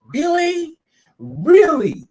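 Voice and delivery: high, incredulous repeated question; asking in total disbelief; real exasperation; exasperated disbelief. Real, not acted. S0 exasperated at the warden.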